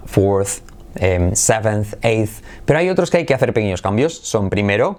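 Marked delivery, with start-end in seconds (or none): none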